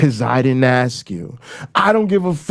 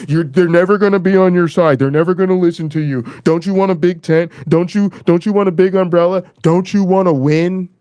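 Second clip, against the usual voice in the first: mocking quote; 5 semitones higher than usual. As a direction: parody their patronizing pitch. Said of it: "You tell us".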